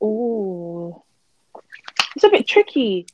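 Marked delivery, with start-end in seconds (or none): none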